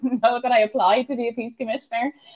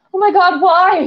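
panicked housemate; 8 semitones higher, 5 dB louder than usual. Retold or imitated. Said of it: "she was like".

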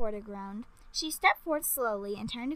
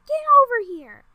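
high, loud shout; urgent call; 12 semitones higher, 12 dB louder than usual. A narrator's reading. Queen shouts for the girl to come to safety, high and loud. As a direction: call her over urgently.

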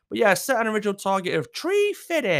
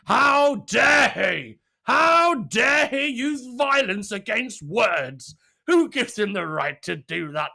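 slow, loud, higher sarcastic indignation; mock outrage; 5 semitones higher, 6 dB louder, much slower than usual. Mocking people angry at the slap, hammed-up outrage. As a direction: ham up fake outrage.

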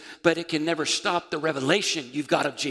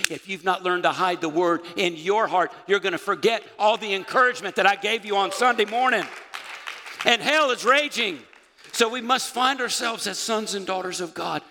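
raised, emphatic preacher's delivery; full-force preaching; 4 semitones higher, 5 dB louder than usual. Acted. Preacher warning the congregation about forgetting, full-force preaching. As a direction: drive it home to the congregation, louder and higher.

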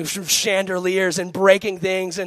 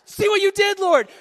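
high-pitched, exasperated whine; mock scolding; 4 dB louder than usual. Flustered man blaming the Lord for her bawling, mock scolding. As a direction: complain upward in a squeaky mock reproach. Mock complaint as the girl bawls.

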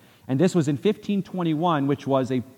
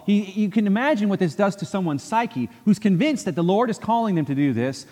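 ordinary talk

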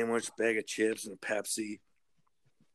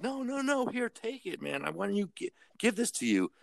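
high-pitched, quieter urging; retold earnest insisting; 10 semitones higher, 3 dB quieter than usual. Coworker offering a man his sandwich, high insistent plea. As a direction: press it on him, pitched way up.

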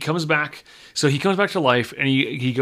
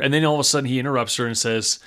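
ordinary talk

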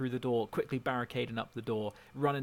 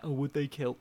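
mocking quote; 2 semitones higher than usual. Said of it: "Don't even give me that".

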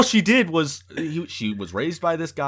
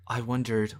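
mock ominous line; 4 semitones lower, 3 dB quieter than usual.

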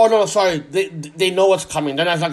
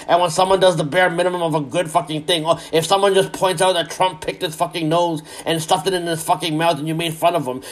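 ordinary talk

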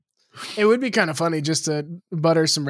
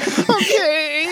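higher, wobbly mock-tearful okay; imitating crying okay; 8 semitones higher than usual. Friend imitates the crying okay, higher and mock tearful. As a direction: mimic someone about to cry. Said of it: "sounded like you were about to cry".

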